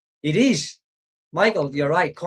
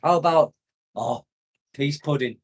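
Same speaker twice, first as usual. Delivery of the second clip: normal talking voice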